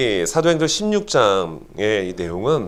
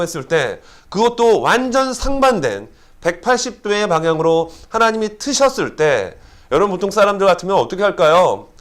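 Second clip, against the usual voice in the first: fervent preaching; 7 semitones higher than usual. Acted. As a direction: preach it with rising fervor. Preaching at full force.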